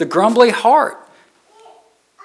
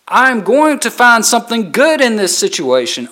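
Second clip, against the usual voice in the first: usual speaking voice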